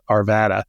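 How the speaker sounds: Arvada with a hard, short A as in cat; harder A sound. Shown as a demonstration. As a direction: say Arvada as ar-VAD-a, leaning on VAD. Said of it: "preferring the, the, uh, harder A sound".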